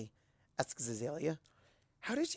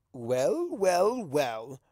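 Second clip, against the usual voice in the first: sly drawn-out greeting; 3 dB louder, much slower than usual. Acted. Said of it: "says Discord's voice from above".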